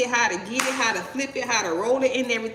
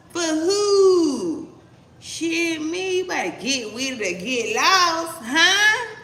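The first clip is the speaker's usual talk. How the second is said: scornful mock reply; 5 semitones higher, 5 dB louder, much slower than usual.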